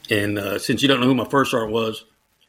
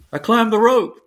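high, louder cry of triumph; retold excited yell; 10 semitones higher, 4 dB louder than usual. Cadet bursting into the shop, high excited shout. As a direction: burst in shouting the good news. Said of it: "someone comes screaming into".

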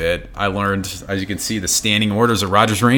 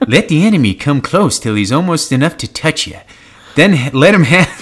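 put-on old-timey prospector voice; 6 semitones higher, 5 dB louder than usual. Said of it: "in that prospector voice".

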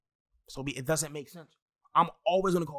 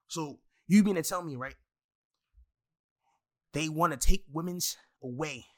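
ordinary talk

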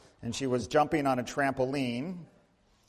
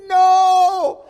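high, loud scream of refusal; screaming child; 14 dB louder than usual. Retold or imitated. A ten-year-old screams at the doctor, loud high shriek. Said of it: "girl screaming".

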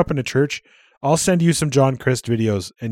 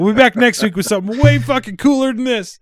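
higher, laughing through the words; laughing sign-off; 10 semitones higher, 5 dB louder than usual. Real, not acted. Host signs off to listeners while laughing. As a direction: giggle the promise out.

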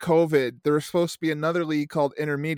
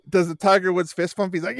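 ordinary talk, nothing marked

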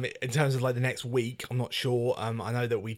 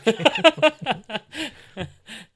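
big laugh; 12 semitones higher, 11 dB louder than usual. Real, not acted. Laughing at joke.

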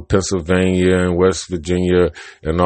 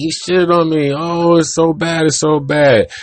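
higher bird voice, wailing at his bad luck; pitched-up comic bird, outraged and sorry for himself; whiny bird; 7 semitones higher than usual. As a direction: complain like a doomed cartoon bird. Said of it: "the bird is like".

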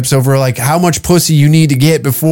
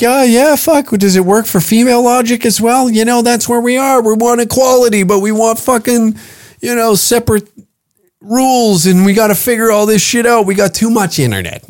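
higher-pitched mock protest; imitating men's grumbling, pitched up; mock men complaining; 6 semitones higher than usual. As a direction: voice a man griping in a higher register. Voices what men reply.

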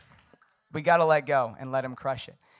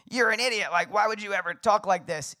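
mock scolding yell, pitched up; imitated yelling; 6 semitones higher, 4 dB louder than usual. Wife berating her husband for how he talks, mock yelling. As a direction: play an exasperated telling-off, moderately raised. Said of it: "my wife yells at me".

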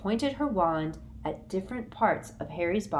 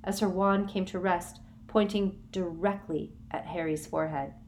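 usual speaking voice

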